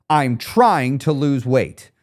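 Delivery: slow, firm and confident. Shown as a demonstration. Coach has listeners say it with him, confident. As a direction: say it slowly with full conviction.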